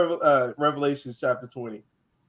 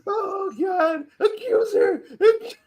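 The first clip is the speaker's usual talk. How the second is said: laughing mimicry; 14 semitones higher, much slower than usual. Friend imitating the preacher's yell while laughing. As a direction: squeal the word through laughter. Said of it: "friend mimics the preacher laughing".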